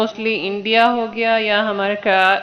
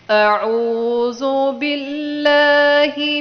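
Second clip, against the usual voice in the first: very slow, drawn-out Quranic recitation; slow recitation for repeating; 2 semitones higher, much slower than usual. Shown as a demonstration. Teacher reciting slowly for students to repeat. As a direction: stretch every syllable for pupils to echo. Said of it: "آپ لوگوں نے میرے پیچھے repeat کرنا ہے".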